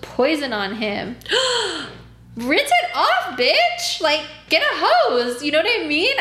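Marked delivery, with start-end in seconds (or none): 1.2-1.9 high sharp shocked gasp. Acted. 2.3-6.1 exasperated yell. Acted. Yelling at absent client.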